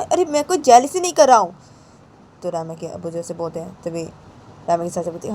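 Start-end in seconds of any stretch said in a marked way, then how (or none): 0.0-1.5 much higher, louder denial; father snapping back at his brother; angry denial. Acted. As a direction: bark that you are not jealous. Father denying jealousy.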